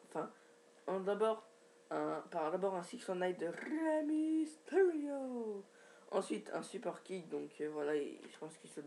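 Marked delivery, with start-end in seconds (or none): 3.5-5.6 higher, long-drawn wrestler name; showy held last syllables; ring-announcer style. Pretend.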